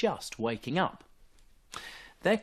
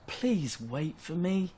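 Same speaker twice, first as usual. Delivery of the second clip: panting; 6 semitones higher, 3 dB quieter, slower than usual. Acted. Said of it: "he panted".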